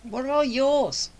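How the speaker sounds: ordinary talk, nothing marked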